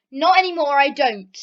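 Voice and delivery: usual speaking voice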